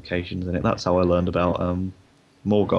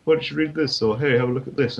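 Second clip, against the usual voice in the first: lightly voiced dad; 6 semitones higher, 5 dB louder than usual. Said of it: "they're like".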